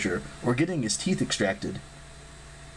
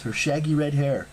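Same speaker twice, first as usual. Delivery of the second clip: ordinary delivery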